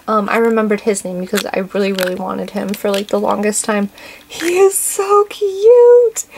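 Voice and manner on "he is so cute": high, louder, slow gushing squeal; cute squeal. Real, not acted. Vlogger gushing over the burger toy, squealing. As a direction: stretch it out in a cutesy squeal.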